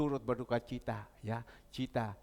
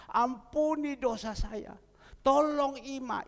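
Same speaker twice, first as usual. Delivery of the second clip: slow, higher "Ampuni dosa saya" then a lifted "Tolong Ima"; retold plea; 9 semitones higher, 3 dB louder than usual. Preacher retelling husband begging God for mercy. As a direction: beg slowly for mercy. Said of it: "suaminya bilang".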